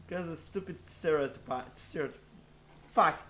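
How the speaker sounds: ordinary talk, nothing marked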